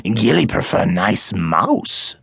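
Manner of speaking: the small forest creature, eager to please; a hint of a quaint creature; little creature voice. Gilly speaks in creature voice.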